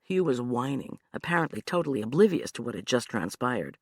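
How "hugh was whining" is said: ordinary delivery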